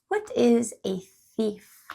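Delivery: usual speaking voice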